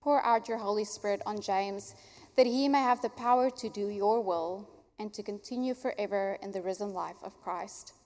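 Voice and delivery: usual speaking voice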